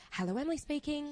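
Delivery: sing-songy receptionist hello; polite phone voice. Shown as a demonstration. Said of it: "I go a bit higher, a little bit nicer".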